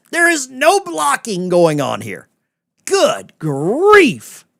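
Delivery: high, loud mock exasperation; sarcastic. Host sarcastically answering a complaining chatter, high and loud. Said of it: "nobody understands sarcasm".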